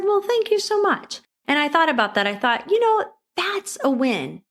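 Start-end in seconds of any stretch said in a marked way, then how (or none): 0.0-1.2 high, lightly voiced thank-you; grateful thanks. Shopper thanking the cashier for the discount, high and grateful. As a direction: lift the voice in delighted thanks. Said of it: "well, I said".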